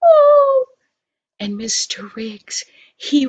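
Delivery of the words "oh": very high, loud sobbing cry of joy; happy cry. Acted. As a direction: burst into happy tears. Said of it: "she started to cry".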